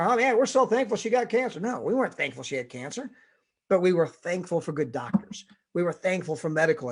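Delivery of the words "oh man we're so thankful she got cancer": higher, sarcastic cheer; mock gratitude. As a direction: say it like the absurd version. Mock ironic thankfulness.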